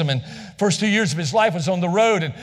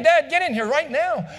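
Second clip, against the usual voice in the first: put-on child's scream; 7 semitones higher than usual. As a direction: yell for dad like a scared kid. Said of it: "he screamed again".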